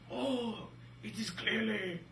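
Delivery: put-on high, hammy French accent. French scientist explaining the bird, hammed-up French accent. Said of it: "amazing French accent".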